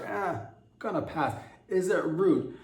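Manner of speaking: hammed-up shrug at the aunt; mock dismissive guy. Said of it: "you're like".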